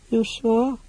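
small, high, anxious question. Acted. Creature checks with the mage before leaving, anxiously. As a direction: ask it timidly, pitched high. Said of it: "Boo-Poo asked anxiously".

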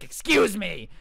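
loud, indignant mom outburst; mock-outraged yell. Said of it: "the mom's yelling at everyone she can".

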